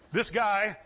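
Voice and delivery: usual speaking voice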